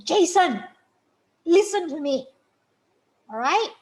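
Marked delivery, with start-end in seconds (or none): 0.0-2.3 raised voice, calling the name sharply; firm yell. Shown as a demonstration. Parent raising voice over child's tantrum, firm. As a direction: cut through the child's noise. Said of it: "you can yell the name".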